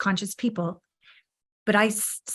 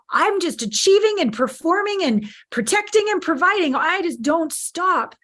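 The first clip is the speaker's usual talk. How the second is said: higher, pleading voice of a worn-out man; imitating the men; 9 semitones higher than usual. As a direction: mimic a tired achiever, slowing on the last words. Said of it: "men crying with me 'cause they're like".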